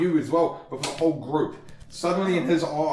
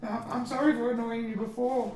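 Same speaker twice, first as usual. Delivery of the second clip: higher, timid little voice; meek apology; 8 semitones higher, 4 dB quieter than usual. Acted. Charmed Sporkblade apologizing to the player, meek and high. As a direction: apologize like a humbled creature. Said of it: "he sort of says to you".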